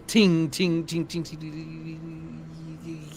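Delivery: sung ting ting ringing; cymbal sound effect. Acted. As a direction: imitate a clattering cymbal. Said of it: "you can hear, like, a cymbal".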